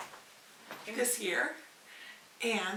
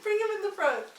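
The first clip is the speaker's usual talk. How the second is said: higher, louder, laughing; laughing urging; 8 semitones higher, 7 dB louder than usual. Real, not acted. Laughing, urging sister to show the kitten. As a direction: urge them on through laughter.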